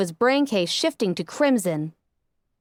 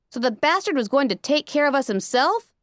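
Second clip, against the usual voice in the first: ordinary delivery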